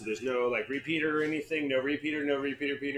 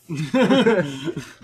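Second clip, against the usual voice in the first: laughing; 3 semitones higher, 10 dB louder than usual. Real, not acted. Laughs at sync result.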